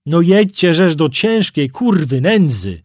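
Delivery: screaming at full throat. Acted. Driver screaming at the cars blocking him, furious yell. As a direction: bellow at the stuck traffic with everything you have. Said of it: "wydarłem się na całe gardło".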